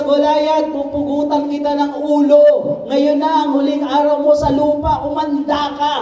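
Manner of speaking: high, loud battle shout; a confident war cry at the giant. Shown as a demonstration. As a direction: shout it out with bold faith. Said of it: "Sumisigaw siya".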